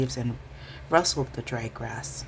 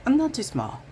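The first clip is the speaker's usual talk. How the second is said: high, louder indignant huff; sulky retort; huffy; 11 semitones higher, 4 dB louder than usual. A narrator's reading. Mouse retorting to Fox, a touch huffy and high.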